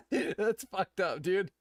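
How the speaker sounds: slow, high, laughing through the words. Real, not acted. Reacting to the boss's quip while laughing. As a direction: get it out while still laughing.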